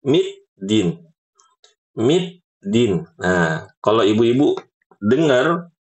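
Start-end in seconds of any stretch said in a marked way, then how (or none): 0.0-2.9 middin with a t sound before the d; wrong middin. Shown as a demonstration. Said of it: "sering banget baca middin".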